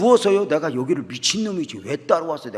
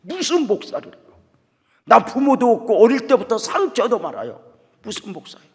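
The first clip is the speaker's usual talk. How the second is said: much higher, louder pleading objection; indignant protest; 10 semitones higher, 9 dB louder than usual. Retold or imitated. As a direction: protest it, almost crying out. Boy protests being a pastor. (목사가 되라는 말에 높은 목소리로 항의)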